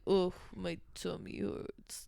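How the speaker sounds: slow, drawn-out complaint; slow plaintive moan.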